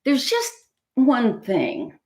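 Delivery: hesitant, lightly voiced piglet; sighing piglet. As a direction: give in, then stall a little. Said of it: "sighed the piglet".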